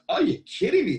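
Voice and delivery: high, loud retold exclamation; amazed disbelief. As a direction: react like the news is unbelievable. Acted amazed reaction in anecdote.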